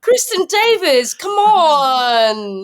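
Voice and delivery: high, stretched-out plea; exasperated appeal. Real, not acted. Fan appealing to the actress, exasperated. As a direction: draw each word out in comic despair.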